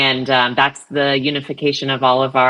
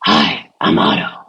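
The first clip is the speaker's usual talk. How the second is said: first Otto voice option; 11 semitones lower than usual. Shown as a demonstration. Auditioning an assistant voice for colleagues, low and husky. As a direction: drop way down into a husky robot greeter. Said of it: "I'll give you three options".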